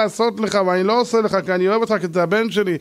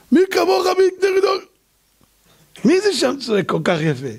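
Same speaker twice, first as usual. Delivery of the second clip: mock him like a teasing child; God mocking Pharaoh's strangled cry; mocking sing-song; 11 semitones higher than usual.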